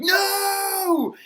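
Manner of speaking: very high, loud shout of refusal; defiant shout. Retold or imitated. Third Estate refuses hat.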